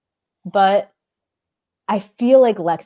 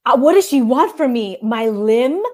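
louder, sneering mock whine; mocking her; 4 semitones higher, 6 dB louder than usual. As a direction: parody her complaint with a sneer. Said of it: "she was like".

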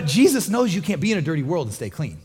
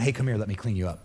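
gentle Jesus voice; 9 semitones lower, 6 dB quieter than usual. Acted. Jesus voiced in sermon quote.

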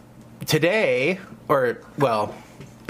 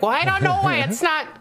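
higher put-on customer voice, Midwest-sounding; customer impression. Imitating a confused store customer, higher put-on voice. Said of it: "gotta do a more Northern Florida accent".

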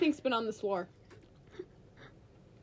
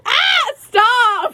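high, loud yelp of protest; real shrieked protest; startled shout; 10 semitones higher, 9 dB louder than usual. Real, not acted. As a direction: yelp and tell them to quit it. Reacts to being hit with toy.

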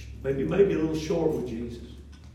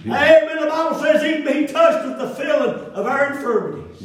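rising, louder preaching cadence; 9 semitones higher, 8 dB louder than usual. Acted. Pastor exhorting the congregation, emphatic preaching.

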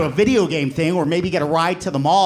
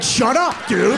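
annoyed retort; 9 semitones higher, 4 dB louder, slower than usual. Retold or imitated. Annoyed retort to singing brother.

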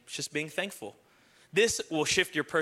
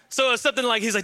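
ordinary talk, nothing marked

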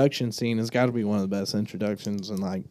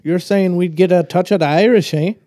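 hammed-up stage-Irish, higher and louder; 6 semitones higher, 6 dB louder than usual. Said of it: "stereotypical, like, Irish accent".

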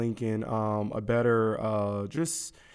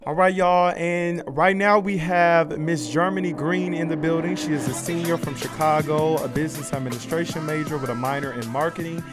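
upbeat announcer voice, higher and louder; radio host intro; 4 semitones higher, 4 dB louder than usual. Acted. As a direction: present the guest like a radio host. Host introduces guest on air.

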